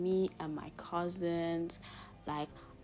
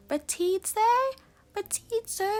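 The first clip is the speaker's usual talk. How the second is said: very high, loud, questioning mock teacher; teacher mispronouncing a name; 13 semitones higher, 9 dB louder than usual. Teacher checking a pupil's name, high-pitched mock confusion. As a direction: stumble on "Ratitze" in a squeaky, rising, unsure voice. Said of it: "they'll just pronounce my name so wrong".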